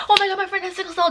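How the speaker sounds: high, rapid mock panic; imitated hysterics. Friends rushing her into hospital, fast high mock hysterics. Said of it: "just, like, hysterically".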